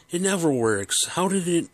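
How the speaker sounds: a touch of a flabbergasted father; confused dad. Retold or imitated. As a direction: sputter in disbelief. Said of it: "He's totally confused".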